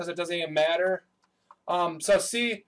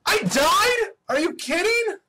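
high-pitched, incredulous outburst; outraged disbelief; 12 semitones higher, 4 dB louder than usual. Real, not acted. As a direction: protest a sudden game death in a high, loud voice. Just died in the race.

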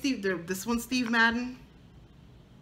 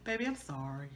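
sheepish apology; 6 semitones lower, 10 dB quieter than usual. Retold or imitated. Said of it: "I said".

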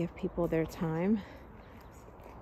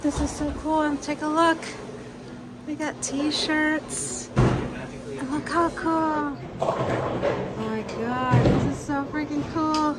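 raised, drawn-out fan gushing; excited gushing; 7 semitones higher, 4 dB louder, slower than usual. Real, not acted. Fan showing viewers the t-shirts, excited. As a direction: gush slowly, pitched up with delight. Gushing over shop at film location.

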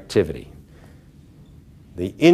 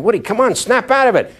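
higher and louder, rallying a friend; pep talk; 12 semitones higher, 3 dB louder, faster than usual. Retold or imitated. Norman phoning Woody every two weeks, loud rallying push. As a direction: push him hard to snap out of it. Repeated phone pep talks.

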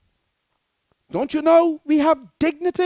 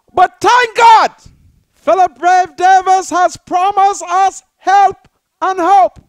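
loud, rising preacher's declaration; triumphant proclamation; 3 semitones higher, 6 dB louder than usual. Acted. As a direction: proclaim the good news. Triumphant proclamation of promised help.

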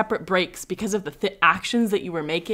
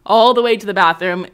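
ordinary delivery

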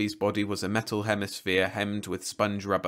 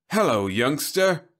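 slow, loud, higher salesman greeting; polished announcer-like robot hello; smooth TV-star robot; 7 semitones higher, 7 dB louder, slower than usual. A narrator's reading. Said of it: "in a voice that was smooth as that of a T V star".

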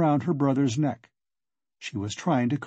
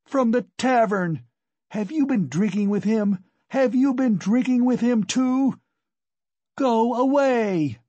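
high, raised frenzied shouting; frantic tirade, ending in a long loud dismissal; furious accusation; 9 semitones higher, 4 dB louder than usual. A narrator's reading. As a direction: round on the stranger in a fury. Enraged wife pounces on the visitor.

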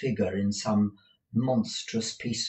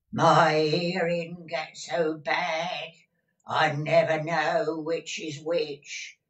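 put-on slow, drawn-out, raised old-crone voice; deaf old woman; 6 semitones higher, 4 dB louder, slower than usual. A narrator's reading. Deaf housekeeper answering her master, slow old-woman drone. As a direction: draw out each word like one hard of hearing. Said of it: "inquired in that subdued tone which deaf people commonly".

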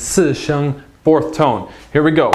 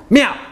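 "Meow" high and abruptly falling; sharp fourth tone; 10 semitones higher, 4 dB louder than usual. Shown as a demonstration. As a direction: put your voice into a sharp, dropping "Meow". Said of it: "put your voice into it".

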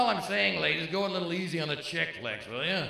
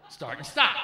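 mock loud; 2 semitones lower, 9 dB louder than usual. Shown as a demonstration. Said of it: "that's what it was".

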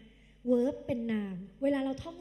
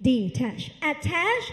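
very slow, louder detach attach; slow drill; 5 dB louder, much slower than usual. Shown as a demonstration. Teacher repeating the drill for students, very slow and loud. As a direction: drag out each word again. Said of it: "พูดตามพี่".

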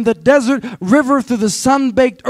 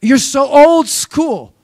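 put-on slow, higher, incredulous teen scoff; mock teenager; 4 semitones higher, slower than usual. Shown as a demonstration. Pastor mimicking a teen scolding his dad, mock scorn. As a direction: drag it out like a disgusted kid. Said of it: "I was waiting for the effect here".

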